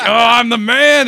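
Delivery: high, loud mock bragging; cocky elation. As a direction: crow it like a giddy show-off. Reenacting cocky flirting with nurse.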